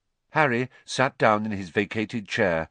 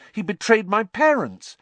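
high, loud wounded protest; Harry, indignant; 13 semitones higher, 6 dB louder than usual. A narrator's reading. Harry refuses a bond with Pettigrew, indignant. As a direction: fling it out, hurt and angry. Boy protests about his parents.

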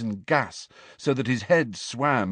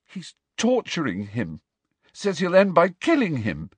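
distressed, with a touch of shake; shaking voice; 8 semitones higher than usual. A narrator's reading. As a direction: let dread creep into the voice. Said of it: "found his voice was shaking".

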